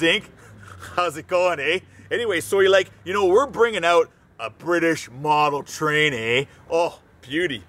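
hammed-up Canadian hoser with lots of eh; Canadian accent. Company president pitching to British buyers in a light Canadian accent. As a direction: lay on the Canadian twang, eh. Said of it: "emphasizing our Canadianness".

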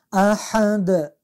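احد drawn out with ح pressed from mid-throat; slow recitation, pressing ح in احد; chanted احد; throaty ح. Shown as a demonstration. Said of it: "اولش الف است".

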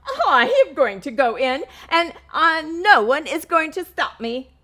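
high, loud, fast determined announcement; determined hen announcing. Acted. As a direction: declare it like a bold hen. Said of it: "she announced".

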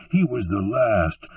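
ordinary delivery, nothing marked

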